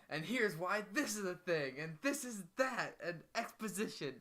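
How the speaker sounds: high, hurried explainer voice; mock exposition dump. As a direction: parody the show info-dumping. Mocking the show's exposition dump.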